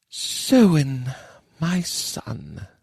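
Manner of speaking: a mother grieving her son; sigh. Acted. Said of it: "Chidre sighed".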